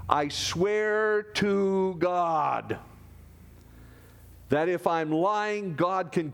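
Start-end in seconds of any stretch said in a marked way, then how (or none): 0.1-2.8 slow oath. Acted. Peter's oath voiced slowly.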